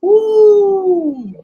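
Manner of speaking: high excited whoop; excited cheer. Real, not acted. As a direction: cheer loudly. Cheering a semifinal pick.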